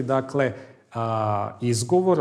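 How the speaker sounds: normal talking voice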